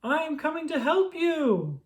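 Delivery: high, strained heroic call; rally to the rescue, up high; crying out. A narrator's reading. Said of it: "cried Toad".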